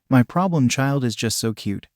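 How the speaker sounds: ordinary delivery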